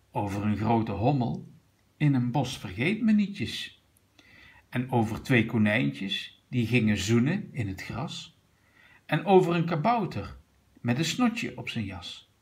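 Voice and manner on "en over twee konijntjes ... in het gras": plain reading voice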